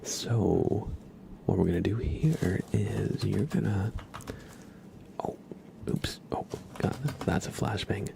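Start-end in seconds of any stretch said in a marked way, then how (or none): none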